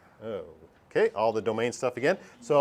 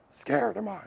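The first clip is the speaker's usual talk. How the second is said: a hint of Yoda, raised higher and soft; lightly voiced Yoda, pitched up; 6 semitones higher, 5 dB quieter than usual. Teacher acting Yoda speaking to Luke, higher. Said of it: "like that".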